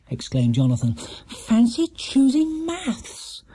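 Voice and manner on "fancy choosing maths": put-on high, drawn-out young boy; lightly voiced schoolboy; boy's voice. Jonathan voiced.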